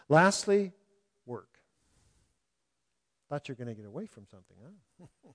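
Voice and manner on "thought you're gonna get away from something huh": teasing. Acted. Teasing the congregation.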